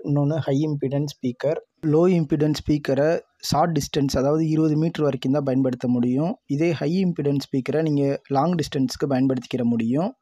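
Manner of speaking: ordinary talk, nothing marked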